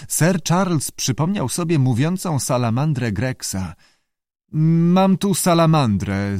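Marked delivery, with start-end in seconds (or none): 4.5-6.4 slow, drawn-out, higher-pitched opening; hesitant. A narrator's reading. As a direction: begin haltingly, stretching the words. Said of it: "zaczął z wahaniem".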